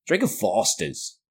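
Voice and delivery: slow, drawn-out fake Australian accent; mock Australian ad again. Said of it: "I can't do an Australian accent".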